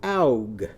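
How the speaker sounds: "Aug" with ow in place of the eh of egg; changed vowel. Shown as a demonstration. Teacher modelling owl's egg for children, "Aug" with an ow vowel. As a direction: start "Aug" with ow, as in a bumped head. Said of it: "It starts with ow".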